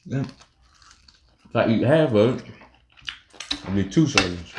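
chewing while talking; talking between bites; mouth full of pizza. Real, not acted. Seen 1: Man bites pizza, then chews through the lines; woman also chewing.

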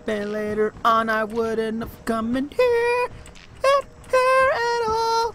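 escalating panic, ending in a yelp; panicked rising voice. Real, not acted. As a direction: voice climbing high in fear. Scared player narrating in panic.

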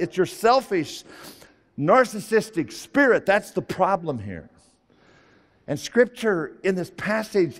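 loud, high, rising sermon climax; preaching at full force. Real, not acted. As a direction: preach it hard, pressing each word home.

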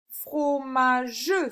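slow "Fromageux" in three beats, ending in a full "jeu"; final syllable sounded. Shown as a demonstration. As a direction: sound out "Fromageux" with an added last vowel. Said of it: "sur la troisième également". (« Fromageux » en trois syllabes, e final prononcé)

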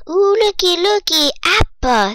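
high, loud delighted call; eager high exclamation; excited discovery. Real, not acted. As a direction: pipe up brightly at a lucky find. Spots an apple drop in game.

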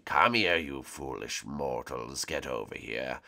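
low, slow menacing voice; dark lord. Acted. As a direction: loom over them like a dark lord. Villain calls mortals.

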